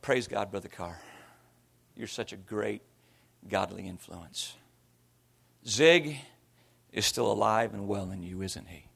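low, hushed, head-hung muttering; dejected self-reproach. Retold or imitated. Ashamed man to himself after smashing the printer, dejected self-reproach. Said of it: "I drop my head and I go".

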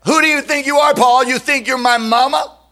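high, affronted "who do you think you are"; indignant retort. Acted. Churchgoer talking back to Paul, high and affronted. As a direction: snap back at Paul with wounded pride.

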